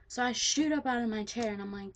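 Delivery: normal talking voice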